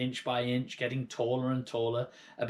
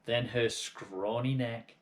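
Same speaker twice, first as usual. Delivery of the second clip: ordinary delivery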